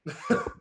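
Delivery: high burst of laughter; a quick amused laugh; short laugh. Real, not acted. Coach laughing at the obvious answer, short laugh.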